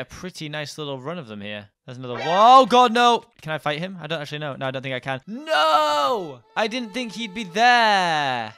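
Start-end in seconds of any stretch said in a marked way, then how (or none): 2.3-8.5 loud panicked no; shouting. Real, not acted. Killed by enemy, shouted no.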